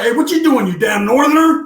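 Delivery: mock heckling yell; taunting call. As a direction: call him out across the yard. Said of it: "He was like".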